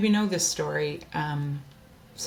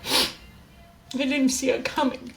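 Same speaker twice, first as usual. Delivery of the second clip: slow and high, voice breaking into tears; real crying on the repeated words; 6 semitones higher, slower than usual. Real, not acted. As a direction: let the tears take the repeat. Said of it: "Sorry, I'm getting emotional".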